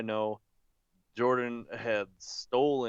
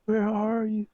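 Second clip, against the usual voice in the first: yelling from far off; 12 semitones higher, 6 dB louder than usual. Retold or imitated. Drunk friend calling from the cornfield, distant yell. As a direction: call out across a field. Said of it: "you could tell he was yelling".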